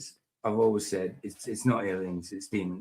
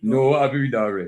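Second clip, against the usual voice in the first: put-on London accent; 4 dB louder than usual. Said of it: "London type accent".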